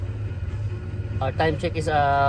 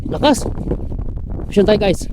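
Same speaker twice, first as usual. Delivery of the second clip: excited shout; 11 semitones higher, faster than usual. Real, not acted. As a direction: yell it as the fish bites. Fish hooked, shouting.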